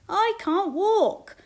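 high, loud, drawn-out plea; calling out. A narrator's reading. Lame man begging Jesus to heal him, calling out. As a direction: call slowly over a crowd. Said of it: "called a lame man".

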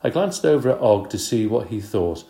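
ordinary delivery

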